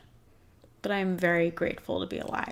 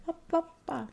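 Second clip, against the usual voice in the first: silly babble; 10 semitones higher, 3 dB quieter, faster than usual. Pretend. Babbling to skip the feelings.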